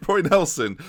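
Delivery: usual speaking voice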